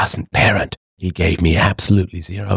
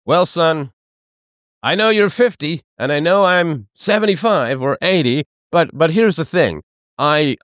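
mock father; 7 semitones higher than usual. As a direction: play the doddering dad offering diapers, pitched up. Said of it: "father voiced in story".

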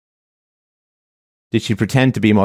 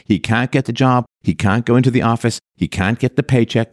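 ordinary talk

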